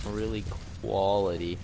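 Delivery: ordinary delivery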